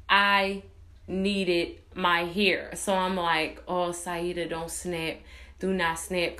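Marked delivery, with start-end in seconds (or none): 3.7-6.4 low, soft talk to herself; self-calming. Retold or imitated. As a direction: talk yourself down under your breath. Soothing self-talk in retold scene.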